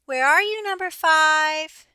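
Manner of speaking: mother duck calling loudly across the yard. A narrator's reading. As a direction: call out from the doorstep for a lost duckling. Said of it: "stood on a doorstep calling".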